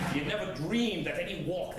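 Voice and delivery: ordinary delivery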